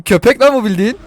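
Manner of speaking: ordinary talk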